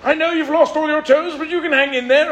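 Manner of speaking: high, plummy mock British consoling; mock stiff upper lip. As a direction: parody a buttoned-up Englishman. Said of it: "they s".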